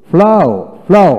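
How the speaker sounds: ফ্লাও high and loud, twice; model reading. Shown as a demonstration. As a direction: call out ফ্লাও for learners to copy. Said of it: "আমি এখন পড়ে শোনাই".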